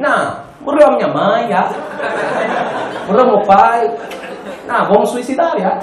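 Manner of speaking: put-on a cheerful Angolan Portuguese accent, pitch riding up on each line. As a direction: say it breezily in an Angolan accent, as if nothing were wrong. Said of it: "Com o sotaque angolano é tipo". (sotaque angolano descontraído, com entoação a subir)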